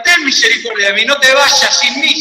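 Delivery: high, loud cry of a begging blind man; imitating a shout. Blind beggar at Jericó calling out to Jesús, loud and desperate. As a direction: call out desperately to be heard. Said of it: "empezó a gritar".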